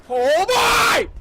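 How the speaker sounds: very high, loud shriek; terrified scream. Real, not acted. Gamer hit by a scare, terrified scream. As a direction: scream it in sudden fright.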